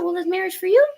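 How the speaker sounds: high-pitched, leading question; lilting up sharply at the end; pointed question. Retold or imitated. Pointed question to fiance, retold.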